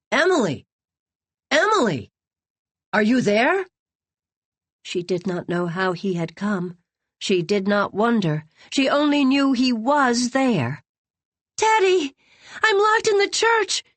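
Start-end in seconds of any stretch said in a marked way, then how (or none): 0.1-3.7 calling her name from outside, higher and louder; urgent calling from outside the door. A narrator's reading. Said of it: "Teddy's voice calling to her". 11.6-13.8 shrieking for help, much higher; girl trapped in a church, shrieking in terror; terrified shriek. A narrator's reading. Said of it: "she shrieked".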